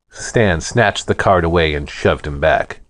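ordinary delivery, nothing marked

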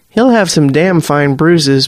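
ordinary delivery, nothing marked

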